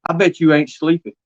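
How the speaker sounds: normal talking voice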